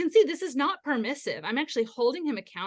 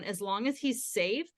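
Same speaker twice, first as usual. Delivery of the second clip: usual speaking voice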